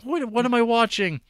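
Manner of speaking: very high-pitched incredulous squeal; baffled outrage. Retold or imitated. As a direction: react like a bewildered, appalled viewer. Acts out baffled viewer reaction.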